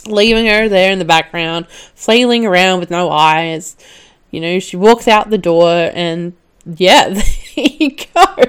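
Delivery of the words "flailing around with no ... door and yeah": normal talking voice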